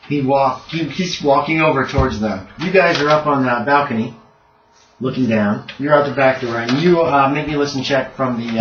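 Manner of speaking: usual speaking voice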